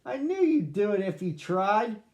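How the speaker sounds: high, loud, proud little master; proud cheer. A narrator's reading. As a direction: praise him warmly but loudly. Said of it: "Sammy cried".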